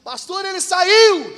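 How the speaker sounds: hammed-up listener voice; mock listener. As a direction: insist like a naive listener. Mock listener answering pastor.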